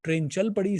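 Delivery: ordinary delivery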